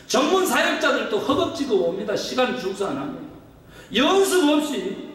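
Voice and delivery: high, driving pulpit exhortation; impassioned preaching. Real, not acted. Preaching rebuke at force.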